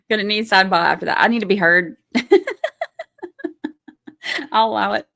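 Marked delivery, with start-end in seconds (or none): 2.1-3.7 high-pitched burst of laughter; real giggling. Real, not acted. Streamer amused by the running joke with viewers, giggling.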